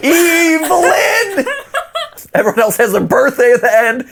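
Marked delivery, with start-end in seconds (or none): 0.1-1.5 laughing. Real, not acted. Laughing at reading the name.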